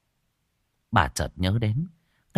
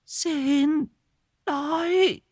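very high, strange madwoman's plea; begging; much slower than usual. A narrator's reading. Madwoman begs the old woman for food, very high. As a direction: whimper for food.

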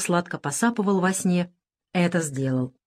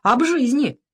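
smirking; 6 semitones higher, 4 dB louder, slower than usual. A narrator's reading. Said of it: "ухмыльнулся раненый".

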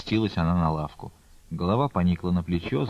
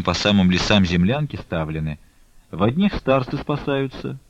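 plain reading voice

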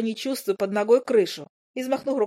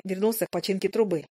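usual speaking voice

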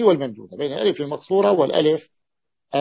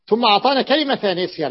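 ordinary talk, nothing marked

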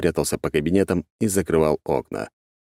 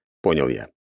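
ordinary delivery

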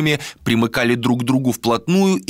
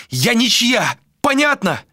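very high, indignant retort; defiant outcry; 13 semitones higher than usual. Acted. Malika pinned by Vilar, defiant outcry. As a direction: throw it back at a high pitch.

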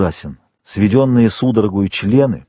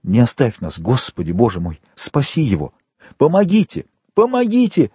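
desperate cry for help; 2 semitones higher than usual. Acted. Dantès calls for help.

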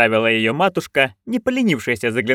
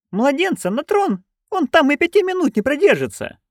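put-on higher, eager old crone; Nanny Ogg voice. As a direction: pitch up into a gleeful old busybody. (высокий азартный голос старой ведьмы)

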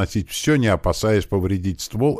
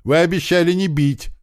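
high, loud wailed protest; crying out; 12 semitones higher, 5 dB louder than usual. A narrator's reading. Young lad protesting the broken promise, crying out. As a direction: wail it like a betrayed lad. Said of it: "закричал парень".

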